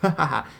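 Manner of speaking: loud mocking laugh; prankster's mocking laugh; sneering laugh. A narrator's reading. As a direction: cackle at the fool.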